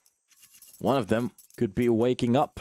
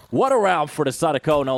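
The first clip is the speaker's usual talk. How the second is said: louder hype call; excited shout; 6 semitones higher, 7 dB louder than usual. Real, not acted. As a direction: bellow the round win. Hype call after round.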